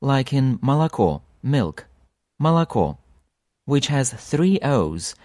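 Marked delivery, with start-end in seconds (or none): 0.6-1.2 молоко with the first two O's as A, said malako; молоко: unstressed O's turn into A, only the last O is clear; reduced unstressed O. Shown as a demonstration. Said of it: "They turn into A's". 2.4-3.0 молоко said malako, only the last O full; молоко: first two O's sound like A; reduced unstressed O. Shown as a demonstration. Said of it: "only the very last one is read as O".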